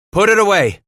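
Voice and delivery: high, loud urgent order; snapped command. A narrator's reading. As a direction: snap it out at an armed man. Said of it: "Titus snapped".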